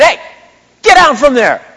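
loud, high barked order; mock guard shout. Preacher imitating the guard ordering him down, loud shout. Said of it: "He said".

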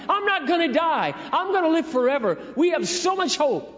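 loud, high, impassioned preaching; preaching at full force. Acted. Preacher urging the congregation that Christ brings hope, in full-force preaching. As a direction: lift the room, calling it out with fervor.

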